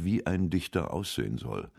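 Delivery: usual speaking voice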